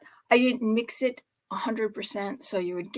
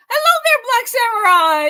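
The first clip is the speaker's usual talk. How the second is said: squealing hello, pitched way up and louder; bubbly host greeting, much higher; high excited greeting; 12 semitones higher, 8 dB louder than usual. Real, not acted.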